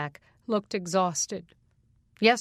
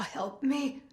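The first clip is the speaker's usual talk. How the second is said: higher, soft airless plea; breathless plea; 6 semitones higher, 4 dB quieter than usual. Acted. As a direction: barely get it out with no air. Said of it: "Sucking air through a straw".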